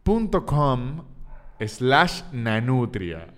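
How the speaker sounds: youtube punto com slash nanutria stretched out very slowly; slow dictation. Shown as a demonstration. Comedian dictating his channel address slowly for listeners. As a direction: spell it out slowly so they can type it. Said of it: "lo está oyendo todo el tiempo mal".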